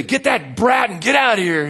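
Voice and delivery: high-pitched, hammed-up cranky order; mock rude usher. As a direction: imitate a nasty usher chasing out a child. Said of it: "no, that's not how we talk".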